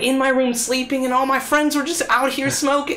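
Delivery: mock-sleepy innocent plea; fake innocent son. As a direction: play the tired innocent kid to mom. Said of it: "And I was like".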